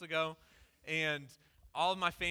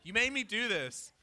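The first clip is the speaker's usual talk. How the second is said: retold complaint, pitched up in mild anger; mad; 5 semitones higher, 4 dB louder than usual. Preacher retelling his complaint to God, mildly mad. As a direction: throw it up at God, mildly indignant. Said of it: "I'm, like, mad at God".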